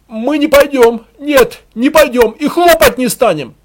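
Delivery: high, loud refusal; defiant heckler. A narrator's reading. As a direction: shout back a firm refusal from the dark. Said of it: "Чей-то голос из темноты".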